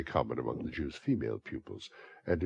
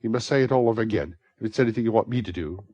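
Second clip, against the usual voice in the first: hasty Nancy; 7 semitones higher, 9 dB louder, faster than usual. A narrator's reading.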